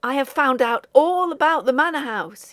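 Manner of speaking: put-on young man bursting with excitement about a haunted house; excited. A narrator's reading. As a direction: blurt it out, eyes blazing with excitement. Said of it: "his eyes blazing with excitement".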